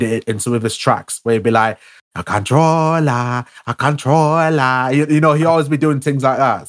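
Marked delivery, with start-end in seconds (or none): 2.1-4.9 slow, drawn-out mock Jamaican accent; fake Jamaican accent. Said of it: "putting on that fake Jamaican accent".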